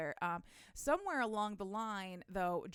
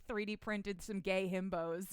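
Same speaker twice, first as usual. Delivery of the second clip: ordinary delivery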